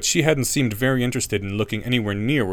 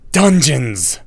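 indignant hiss; furious boy voice; 3 semitones higher, 7 dB louder than usual. A narrator's reading. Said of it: "Harry hissed".

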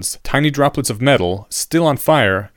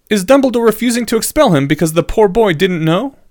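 high, louder pupil voice; questioning student; 10 semitones higher, 5 dB louder than usual. A narrator's reading. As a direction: ask pointedly in a raised student voice.

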